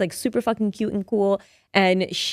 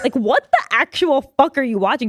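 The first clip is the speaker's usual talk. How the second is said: higher scolding, leaning on "actual"; emphatic outraged mom; 5 semitones higher, 3 dB louder than usual. Retold or imitated. Mom scolding child over the show, emphatic mock outrage. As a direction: repeat the demand higher, appalled, hitting "actual".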